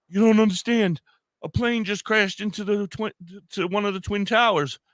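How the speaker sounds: high, urgent imitation of a distraught caller; retold tearful panic. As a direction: play a shocked wife breaking news, pitched high.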